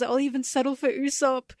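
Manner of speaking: ordinary talk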